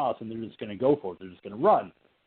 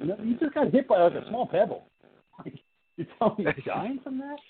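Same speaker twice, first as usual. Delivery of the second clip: higher, disbelieving comeback; incredulous retort; 10 semitones higher than usual. Retold or imitated. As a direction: sound baffled that a pebble could kill her. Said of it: "I'm like".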